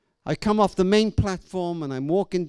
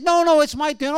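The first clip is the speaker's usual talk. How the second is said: higher, louder mock squabble; child's protest; 8 semitones higher, 6 dB louder than usual. Daughter objects over the front seat, retold protest. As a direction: imitate a girl insisting on her turn.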